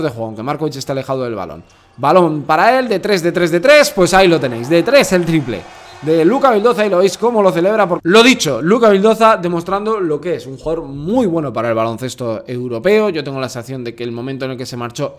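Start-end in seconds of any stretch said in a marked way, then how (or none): 2.0-13.1 raised, punchy call of a three-pointer; play-by-play commentary. Acted. Commentator celebrates Luka's triple for viewers, excited play-by-play. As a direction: hammer out the call, voice lifted.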